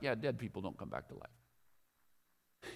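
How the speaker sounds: low, quiet, slow offhand aside; muttered, world-weary dismissal; dismissive sceptic. Acted. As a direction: wave it away like a knowing sceptic. Mimics smug modern sceptic.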